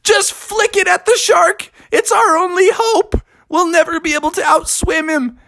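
very high, urgent mermaid voice. Acted. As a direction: plead at the top of your range. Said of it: "And she goes".

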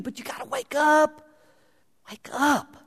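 high urging voice of Jesus. Acted. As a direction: plead with the sleeping church. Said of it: "he says".

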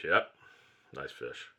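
mock the father's lukewarm shrug in words; unenthusiastic. Father shrugging off the son's praise of his catch, mildly unenthused. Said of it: "No enthusiasm, no excitement".

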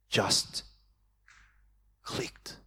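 breathed out, barely voiced; a hushed whisper of realisation; awed whisper. Real, not acted. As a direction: let the words fall to a whisper. Moment of revelation.

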